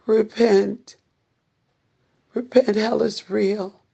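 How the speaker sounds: urgent pleading preacher, pressing hard; doomsday street preacher. Acted. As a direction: plead with them to repent now.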